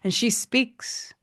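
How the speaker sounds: normal talking voice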